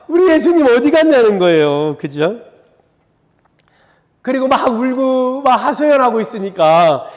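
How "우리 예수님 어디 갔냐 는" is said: a touch of sobbing, pitched up; half-hearted imitation of wailing grief; mild mock wail. Weeping woman at the empty tomb, lightly crying out. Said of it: "엉엉 울다가".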